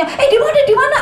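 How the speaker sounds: high, loud frantic question; scared disciple looking around; panicky asking. Pretend. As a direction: ask anxiously where it is.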